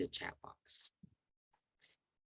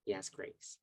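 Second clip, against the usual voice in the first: usual speaking voice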